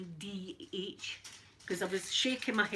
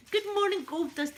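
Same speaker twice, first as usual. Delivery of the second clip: much higher, louder hello; bright greeting; 10 semitones higher, 6 dB louder than usual. Real, not acted. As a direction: sing out the welcome. Greeting viewer in chat.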